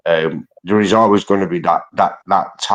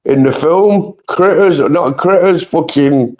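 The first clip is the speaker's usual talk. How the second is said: high, slow, louder; excited; 10 semitones higher, 3 dB louder than usual. Real, not acted. Explaining to his mate why he's a gremlin, high and gleeful. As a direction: build up the gremlin idea gleefully.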